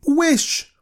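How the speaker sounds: very high, loud command; strong voice. A narrator's reading. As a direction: cry out the order forcefully. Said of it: "she cried in a strong voice".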